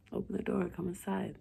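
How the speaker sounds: soft whispered coaxing; luring whisper. Acted. Patient luring the doctor inside, hushed whisper. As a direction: coax her in, barely voiced.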